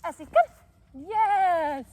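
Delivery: shouted high call, then stretched "yes"; really loud, high recall. Real, not acted. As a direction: holler the dog over and cheer. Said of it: "in a really loud".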